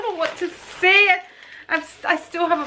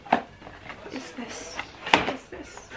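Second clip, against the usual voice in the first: hushed disbelief; 3 semitones lower, 9 dB quieter, slower than usual. Real, not acted. Stunned, hushed at opening the box.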